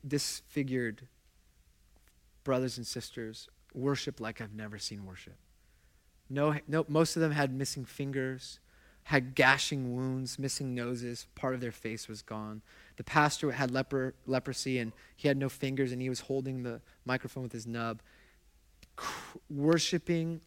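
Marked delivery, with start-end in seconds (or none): none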